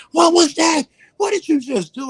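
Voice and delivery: very high, frantic questioning; panicked. Retold or imitated. Gang leader shrieks after the book touches him, panicked. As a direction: shriek it in disbelief.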